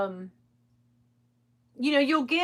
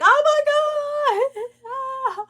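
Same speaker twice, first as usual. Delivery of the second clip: much higher, louder wail of dismay; retold distraught cry; 14 semitones higher, 6 dB louder, faster than usual. Losing her selling account, a high loud wail. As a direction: cry out as if your income just vanished. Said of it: "I was like".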